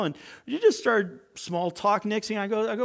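ordinary talk, nothing marked

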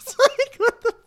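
high-pitched giggle breaking through words; laughing. Real, not acted. Cracking up over a line.

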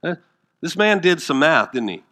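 ordinary delivery

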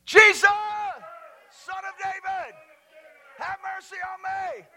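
high, drawn-out slow shout; full shout. Shown as a demonstration. Preacher leading the congregation in a shout, shouting. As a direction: call it out long and high for a crowd to join. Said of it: "I want you to shout it with me".

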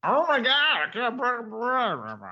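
talking through a mouthful; mouth full. Real, not acted. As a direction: chew while you talk. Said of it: "Sorry, I'm eating corn chips".

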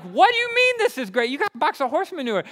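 high, loud incredulous cry; can't believe he likes it; baffled disbelief. Retold or imitated. Kids baffled by his joy over manure, incredulous.

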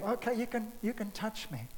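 a soft voice inviting doubting disciples closer; tender reassurance. Retold or imitated. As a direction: coax them gently to touch. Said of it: "the tenderness of how he approaches".

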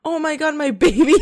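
ordinary talk, nothing marked

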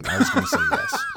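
high squeaky laugh through the words; laughing at own joke about southern men. Real, not acted. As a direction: crack up mid-answer. Joking about deadly southern men.

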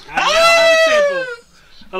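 high booming villain shout; cackling mad scientist triumph. Pretend. Mad scientist taking a sample.

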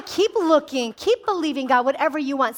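usual speaking voice

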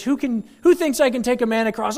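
high, loud ringmaster call; showman's dare. Acted. Preacher replays the daredevil's dare, high loud. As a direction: dare the crowd. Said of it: "the crowd that cheers".

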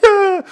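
short, very high, loud squeal; high mock yelp. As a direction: let out a silly high yelp. Yelp after the whiny quote.

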